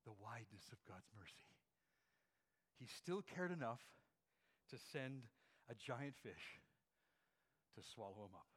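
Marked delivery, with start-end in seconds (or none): none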